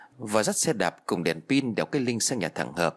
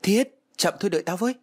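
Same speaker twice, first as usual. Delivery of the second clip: high, raised narrated shout; shouting after someone; 9 semitones higher than usual. A narrator's reading. Trang chases Thiết in a dream, shouting after him. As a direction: call out urgently, pitched well up. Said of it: "Tôi liền gào lên".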